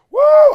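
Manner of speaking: loud high-pitched "woo"; whooping. Real, not acted. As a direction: let out a big drawn-out whoop. Exclaims over eventful weekend.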